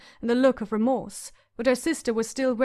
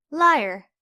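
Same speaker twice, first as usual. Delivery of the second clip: Arya's angry retort; 4 semitones higher, 6 dB louder than usual. A narrator's reading. Arya accuses her sister.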